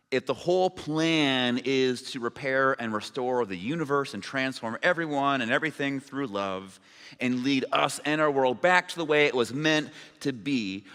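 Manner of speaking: normal talking voice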